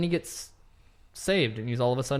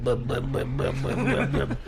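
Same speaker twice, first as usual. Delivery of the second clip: engine sputter. Retold or imitated. Imitating the boat engine sputter.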